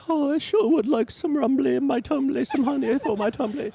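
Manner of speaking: Winnie the Pooh voice. Pretend. Reading a bedtime story to his kids, as Winnie the Pooh. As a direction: play a sweet honey-loving bear. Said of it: "you're doing Winnie the Pooh".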